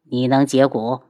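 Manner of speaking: put-on low elderly master voice; low, skeptical old man. A narrator's reading. (老者低声)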